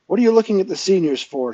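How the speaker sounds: loud, high, quick demand; sharp challenge. A narrator's reading. Shang Wildcat confronting Owen, sharply challenging. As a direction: fire the question at a distracted pupil.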